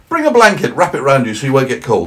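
high, loud cheery snowman. Acted. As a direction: play the indignant snowman, high and bright. Snowman voiced.

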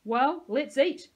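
big, loud, slow boom. A narrator's reading. Peyton calling his group to food, booming. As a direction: announce it to the whole group, booming. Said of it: "Peyton boomed".

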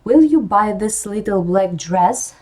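first half of the alternative question, rising; rising tune. Shown as a demonstration. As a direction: hold the question open with a slow rise. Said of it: "Висхідна інтонація і несхідна".

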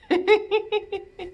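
high light giggle; high, rippling laugh. Real, not acted. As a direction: giggle in a quick run. Laughs after the song.

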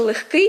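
легкий loud on the final -КИЙ; stress on ending. Shown as a demonstration. Teacher models the adjective, легкий stressed on the ending. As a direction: hit the last syllable of легкий. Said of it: "наголос ставимо на".

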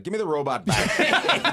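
slow, loud, laughing demand; loud laughing plea. Retold or imitated. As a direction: beg for the robot back. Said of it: "You're like".

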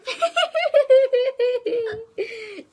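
loud high-pitched laughing burst. Real, not acted. After forcing friend to eat.

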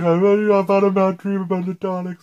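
high, loud, panicky retelling of dad; imitating dad. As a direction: act out dad waking from a nightmare. Dad's dream retold in story.

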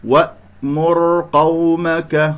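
وأمر قومك very slowly, a thick ر held briefly; heavy flowing ra. Shown as a demonstration. Teacher showing the ra, slow chant with heavy sustained ر in وأمر. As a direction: chant وأمر, letting the dark ر run on. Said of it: "الراء ساكنة قبلها مضموم تكون مفخمة".

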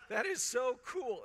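high, loud and slow with delight; squealing that is so cool at the monks' habits; gushing waitress. Retold or imitated. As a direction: gush it like a thrilled waitress. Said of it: "They go".

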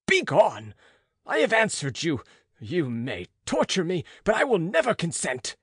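loud, high furious defiance; burning rage. Acted. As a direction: hurl it at the monster in fury. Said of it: "I could no longer suppress the rage".

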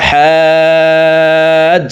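হাজ with the vowel stretched very long; long drawn-out vowel. Shown as a demonstration. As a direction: hold the a in হাজ before closing on the j. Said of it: "চার আলিফ টেনে".

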